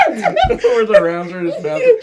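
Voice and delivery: high, loud laughing ricochet noises. Real, not acted. Friend recalling bouncing rounds, laughing hard. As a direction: squeal the bullet sounds through laughter.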